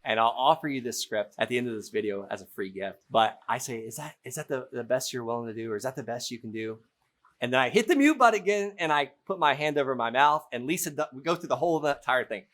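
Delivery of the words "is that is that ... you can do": deflated. Shown as a demonstration. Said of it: "I just sound deflated".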